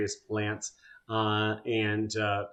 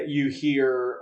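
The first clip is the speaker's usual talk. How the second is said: ordinary talk, nothing marked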